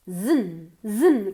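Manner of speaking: buzzed "zin", almost no vowel; barely open mouth. Shown as a demonstration. Teacher showing cousin's ending, nearly closed-mouth "zin". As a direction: slide from a voiced z straight to n in "zin". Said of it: "Casi no abro la boca".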